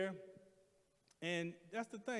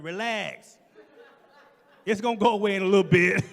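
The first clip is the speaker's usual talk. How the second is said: loud, fast mock reassurance; mocking the nurse; 2 semitones higher, 15 dB louder than usual. Patient mockingly repeating the nurse's brush-off. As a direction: throw her words back at her. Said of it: "So I said what she said".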